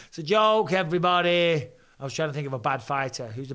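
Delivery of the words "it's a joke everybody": raised, louder call-out; loud disclaimer. Real, not acted. Podcaster assuring viewers he was joking, loudly. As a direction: announce it to the whole audience.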